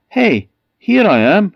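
high, loud eager cry; greedy boy's excited call; eager child call. Acted. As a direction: pop out eagerly calling.

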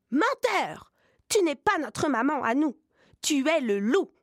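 put-on high little goat kids yelling; kids crying out. A narrator's reading. Goat kids unmask the wolf at the door, high indignant cry. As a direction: call out the liar, high and shrill-voiced children. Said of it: "les biquets s'écrient".

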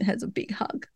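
whispered, touched; hushed aside. Real, not acted. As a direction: breathe it softly. Sweet hug being described.

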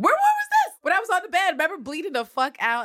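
high, loud mock outrage; indignant, comic interrogation at full tilt; hammed-up confrontation. Villanelle confronts Eve about being shot, mock outraged.

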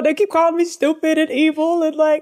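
high, fast, hammed-up whine; whining King Ahab. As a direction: pour out a mock tearful self-pitying rant. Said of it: "And King Ahab goes".

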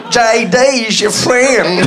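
high, slow, breathy-free Southern belle imitation, laughing. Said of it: "He sounds like a Southern belle".

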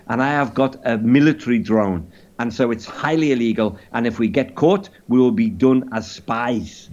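measured mock-French confession; caricature French accent. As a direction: explain gravely in a put-on French accent. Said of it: "in a caricature French accent".

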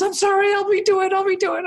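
high, frantic imitated apology; flustered hairdresser. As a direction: mimic a hairdresser rushing to apologize. Retold flustered hairdresser apologizing to crying bride.

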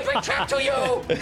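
shrieking high cackle then squeaky shout; crazed cackling character. Acted. Bido firing the ballista, manic cackling shout. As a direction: cackle it out like a wild little engineer. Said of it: "he goes like".

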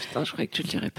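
unvoiced whispered aside; whispering. Real, not acted. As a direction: whisper it under the breath. Aside to co-host.

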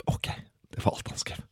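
hushed aside, barely voiced; whisper. Real, not acted. Deadpan aside after short SMS.

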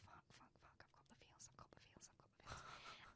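barely audible whispered panic; panicked whisper. Retold or imitated. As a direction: whisper it to yourself in a flap. Her inner panic retold.

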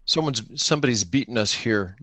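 ordinary talk

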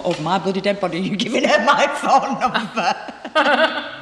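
slow, laughing mock indignation; laughing mock outrage. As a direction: laugh through the playful protest. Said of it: "And I used to go".

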